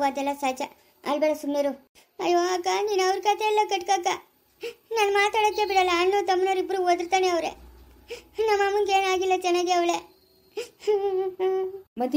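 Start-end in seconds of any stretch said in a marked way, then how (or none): 2.2-10.0 higher, tearful voice breaking into sobs; crying complaint. Acted. Geetha complains through tears to her sister-in-law, crying.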